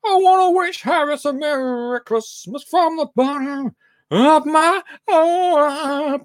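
high, slow sing-song message; quoting a listener. Retold or imitated. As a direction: read it out grandly, slowly. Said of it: "says Liam".

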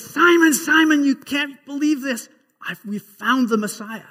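an eager brother who can't wait to share the news; excited calling. Acted. Andrew bursts in on his brother Simon, breathless and excited. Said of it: "Andrew is so excited".

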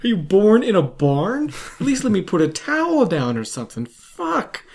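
high, loud exasperated scold; mock scolding. Host scolding the bloody guest, loud high exasperation. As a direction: scold him for his manners.

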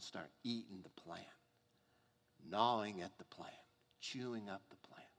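hushed whisper. A narrator's reading. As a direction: breathe the words out, no voice. Worm eats plant, whispered.

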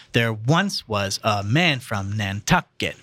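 "There once was a man from Nantucket" slowly, beating ONCE, MAN, TUCK; metrical stress demo. Shown as a demonstration. Host teaches amphibrachs, "There once was a man from Nantucket" slow with marked beats. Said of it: "Unstressed, stressed, unstressed".

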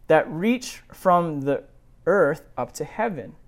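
steady, measured Bible reading; scripture reading. A narrator's reading. Pastor reading Genesis aloud to the congregation, measured. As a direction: read the passage evenly to the congregation.

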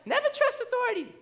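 very high-pitched French teacher imitation; mock French accent. Teacher warning the French class, in a mock French accent. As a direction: squeak it out in a bad French accent. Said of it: "gonna attempt a French accent".

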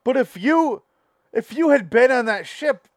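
loud, high put-on socialite voice; waspy interrupter. Waspy couple quibbling with the storyteller, loud put-on voice. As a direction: interject as the waspy know-it-all. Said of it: "the waspy couple's like".

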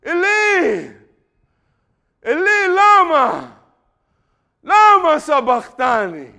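slow, high scream of agony; loud, drawn-out wail of abandonment; Jesus screaming. Acted. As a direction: scream it out, stretching each word. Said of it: "and it makes him scream".

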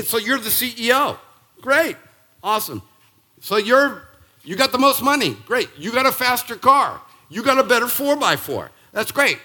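high, loud sarcastic cheering; mock the status symbols with fake enthusiasm; sarcastic mock praise. Preacher to congregation, mocking worldly success, sarcastic and loud.

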